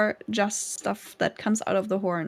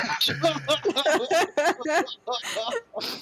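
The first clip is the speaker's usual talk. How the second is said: long rising laugh; 6 semitones higher than usual. Real, not acted. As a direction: let the laugh build. Players laughing.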